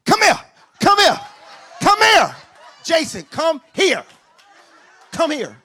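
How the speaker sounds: a mother hollering for her son to come here; mom's yell. Retold or imitated. Mom bellowing at her grown son to come stand here. Mom yelling at brother at wedding.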